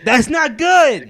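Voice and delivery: high, loud, drawn-out protest; outraged holler, stretched out; exasperated shout. Real, not acted. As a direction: yell it in exasperation. Exasperated at bad impressions.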